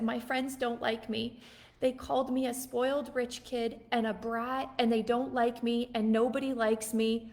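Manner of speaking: ordinary talk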